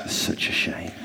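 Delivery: soft, whispered such a shame; hushed disapproval. Retold or imitated. Mum quietly dismissing Mister Tumble, a soft whisper. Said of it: "And my mum said".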